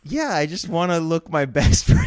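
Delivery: mock-earnest through laughter; laughing. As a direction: fake sweet, cracking up. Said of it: "I can't even say it with a straight face".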